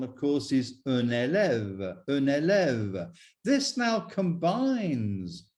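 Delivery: usual speaking voice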